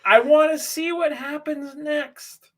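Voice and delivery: high mock fan voice. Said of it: "And everybody's like".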